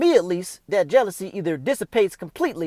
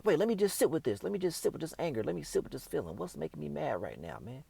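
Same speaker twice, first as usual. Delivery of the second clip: soft, inward, talking himself down; calm yourself under your breath, softer; softly reasoning with his own anger; quiet self-talk; 2 semitones lower, 10 dB quieter than usual. Pretend.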